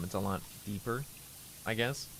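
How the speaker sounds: normal talking voice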